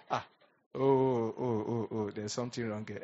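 hammed-up cry, dropping low and fading; a mock wail that sinks into a murmur.

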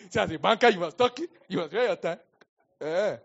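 loud, high, laughing through the words; a preacher teasing bankers in the church, laughing loudly. Real, not acted. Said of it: "laughing loud aside".

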